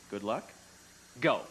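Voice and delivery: ordinary talk, nothing marked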